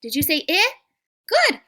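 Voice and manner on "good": much higher, louder Good; excited praise. Real, not acted. Teacher praising kids who said the sound. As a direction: squeal Good with delight.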